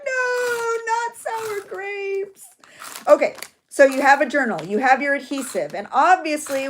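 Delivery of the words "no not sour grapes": high, drawn-out hammed-up wail; mock wail. Crafter reacting to friend's sour grapes, high mock wail. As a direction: wail it in comic despair, stretching the last words.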